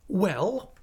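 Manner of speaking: high, rising query from a stern aunt; aunt, questioning. A narrator's reading. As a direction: ask it high and expectant.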